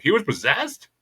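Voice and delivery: high, rising incredulous question; confused disbelief. Retold or imitated. Imitating Jo, unsure what's going on, high confused disbelief. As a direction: pitch leaps up in mock bewilderment. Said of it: "still unsure what the fuck's going on".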